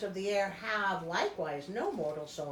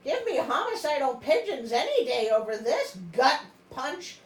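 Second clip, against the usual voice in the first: usual speaking voice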